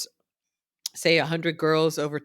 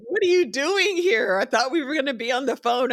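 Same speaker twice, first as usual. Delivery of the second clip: surprised lawyer; 9 semitones higher than usual. Retold or imitated. Lawyer surprised she came from her recovery bed, high and astonished. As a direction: act shocked she showed up. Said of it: "And he's like".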